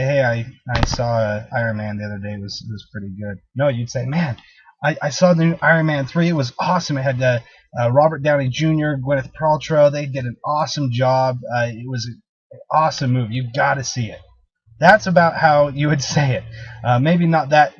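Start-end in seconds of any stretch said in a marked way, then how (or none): 0.0-3.4 low-key, quick and trailing away softly; indifferent, barely bothering to recommend it; unenthusiastic. Shown as a demonstration. Trainer showing how not to tell a friend about a movie, unenthusiastic. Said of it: "Probably with enthusiasm, right? You didn't say". 4.0-14.2 keen movie rave with punched words; enthusiastic. Shown as a demonstration. Trainer showing how to tell a friend about a movie, enthusiastic. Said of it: "maybe not that intense, ... a bit of enthusiasm".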